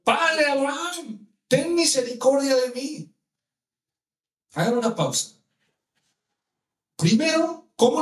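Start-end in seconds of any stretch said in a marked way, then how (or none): 0.0-3.1 crying out. Acted. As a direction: cry out for mercy, pitched high. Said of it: "dando voces, dijo, ¿qué clama?". (clamando a voces, súplica actuada)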